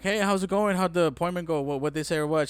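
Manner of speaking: high, loud rapid-fire retold questions; eager questioning. Partner on the phone asking about the appointment, acting eager. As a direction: pepper them with questions.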